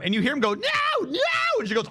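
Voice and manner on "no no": very high-pitched imitated scream; imitating panicked cries at normal volume; mock screaming. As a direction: squeal like a tiny creature being killed. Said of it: "you just hear him screaming".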